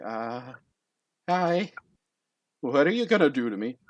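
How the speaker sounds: timid, mildly nervous volunteer; trembling. Acted. Trembling volunteer to the giant, timid question. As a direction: ask it with a touch of fear.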